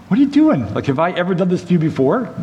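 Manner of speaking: donkey protesting to Balaam, high and indignant; talking donkey. Acted. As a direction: play the hurt, baffled donkey pleading with its master.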